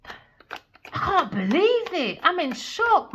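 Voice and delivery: high, slow stunned voice; mock shock. Reader in mock shock over the card, slow and high. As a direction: stretch out the disbelief.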